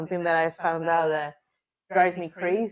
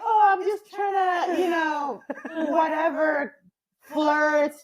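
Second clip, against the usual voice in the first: mocking flirty texter; 7 semitones higher, slower than usual. Mocking men who text flirty emoji, in a high silly voice. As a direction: ham up a silly texter showing off. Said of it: "some people are like".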